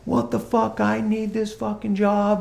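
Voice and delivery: high-pitched mock outburst; retold losing it. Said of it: "he starts losing it again".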